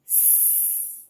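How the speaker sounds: a long hissing s; s with the mouth widened; s sound. Shown as a demonstration. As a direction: hiss s. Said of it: "So with the S".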